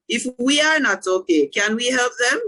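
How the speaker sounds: ordinary talk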